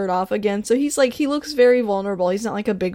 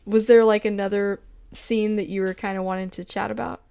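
ordinary talk

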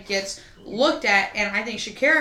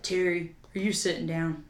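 soft, lower, serious dad voice; grave quiet warning; 6 semitones lower, 9 dB quieter than usual. Retold or imitated. Dad calling mom with news, low quiet gravity. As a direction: break bad news gently, quietly. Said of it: "and was like".

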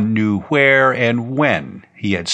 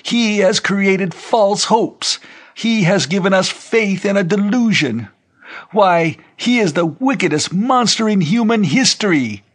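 crying out in accusation; 10 semitones higher than usual. A narrator's reading. Bearded old man accusing the youth, crying out high. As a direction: denounce him like a wrathful preacher. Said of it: "the old man with the beard cried".

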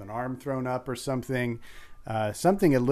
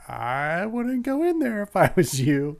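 high, slow, eerie warning; drawn-out ominous caution to riders; spooky warning voice; 7 semitones higher, 3 dB louder than usual. Pretend. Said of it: "is looking right at you going".

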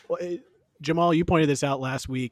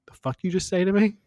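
quick, loud, higher mock outrage; mock furious snap; 7 semitones higher, 5 dB louder, faster than usual. Gail fired up at a contestant, quick mock angry snap. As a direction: play Gail snapping back in outrage. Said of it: "and then she's like".

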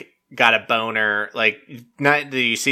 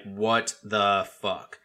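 slow, quieter mock disbelief; cold disbelief; 3 semitones lower, 8 dB quieter, much slower than usual. Group cold staring at Dale, retold icy disbelief. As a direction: space the words out, low-key and icy. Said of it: "everyone's just cold staring him".

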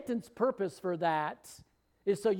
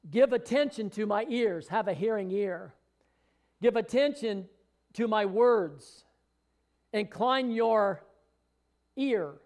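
loud, slow, emphatic scripture reading; preaching scripture; 2 semitones higher, 4 dB louder than usual. Acted. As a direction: proclaim each phrase to the congregation. Preacher reads Proverbs passage.

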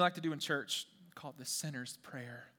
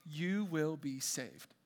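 softer, drawn-out final statement; slow, settled promise; 2 semitones lower, 5 dB quieter, much slower than usual. Real, not acted. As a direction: let each word land gently and slowly. Scripture promise repeated after saying period.

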